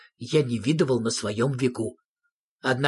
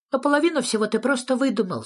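high, quick character voice; high-pitched doubting little creature; sceptical Sniff; 11 semitones higher, faster than usual. A narrator's reading. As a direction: blurt the accusation fast and high. Sniff sceptically challenges the storyteller.

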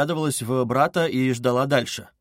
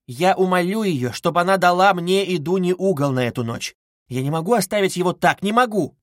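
high, louder, distressed appeal; urgent mother's plea; insistent pleading; 5 semitones higher, 5 dB louder than usual. A narrator's reading. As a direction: insist anxiously, refusing to give way. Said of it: "настаивала Пульхерия Александровна".

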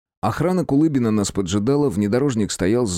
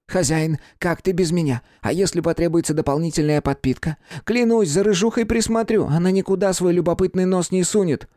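higher, begging little familiar; pleading pet creature; 9 semitones higher than usual. A narrator's reading. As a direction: beg the master to take you. (просящий голосок питомца)

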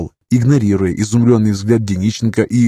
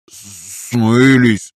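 gloomy. A narrator's reading. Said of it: "мрачно подытожил Дениченко".

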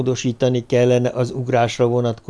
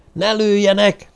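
shouting; 9 semitones higher than usual. Acted. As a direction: shout it out so the guards won't shoot. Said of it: "kiáltotta".

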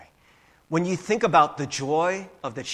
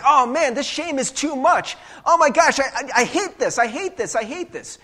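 higher, louder hammed-up anguish; mock anguished Jesus; 8 semitones higher, 5 dB louder than usual. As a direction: overplay the despair, whining. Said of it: "He's like".

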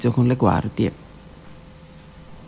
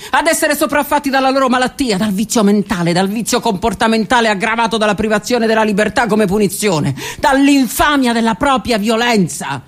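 impassioned outburst; 10 semitones higher than usual. Acted. Railing against prisoners' hopeless fate, furious high outcry. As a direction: hurl the words out in anger.

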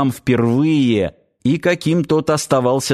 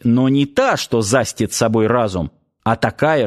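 ordinary delivery

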